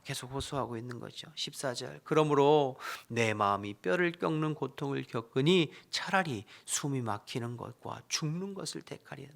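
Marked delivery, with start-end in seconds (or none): 2.0-9.3 dramatic lament reading of Job; loud, earnest scripture reading. Acted. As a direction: read the verse as Job's anguished plea. Reads Job's lament aloud.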